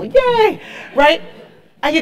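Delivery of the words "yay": high squeal of support; cheerful yay. Retold or imitated. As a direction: cheer like a happy fan. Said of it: "Thank God for those people".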